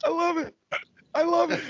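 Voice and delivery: delighted. Real, not acted. Delighted by the Aussie rant.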